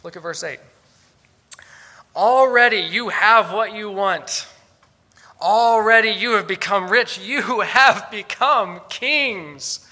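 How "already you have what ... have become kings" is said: loud, drawn-out sarcastic proclamation; mock their success with booming false admiration. Paul to the self-satisfied Corinthians, loudly sarcastic. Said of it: "Do you hear the sarcasm?".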